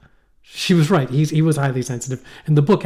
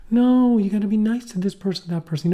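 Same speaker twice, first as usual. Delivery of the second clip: higher-pitched imitation of a wife; imitated wife; 8 semitones higher than usual. Wife urging husband to be nice, higher-pitched imitation. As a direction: gently mimic a wife urging. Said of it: "my wife always goes".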